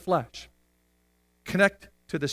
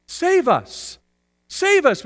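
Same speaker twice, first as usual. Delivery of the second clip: raised, pleading call; crowd crying out; 10 semitones higher, 4 dB louder, slower than usual. Acted. Preacher acts the crowd begging to be saved, crying out. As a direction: cry out for rescue. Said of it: "people are all crying out".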